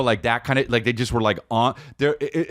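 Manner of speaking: normal talking voice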